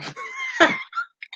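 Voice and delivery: a high squeal of a laugh. Real, not acted. Laughing at her own teasing of Nick, with a high squeal. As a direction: let out a high giggle.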